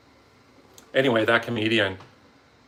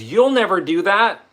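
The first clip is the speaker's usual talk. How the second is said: heckler's jab; 9 semitones higher, 8 dB louder than usual. Retold or imitated. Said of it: "The way she said it".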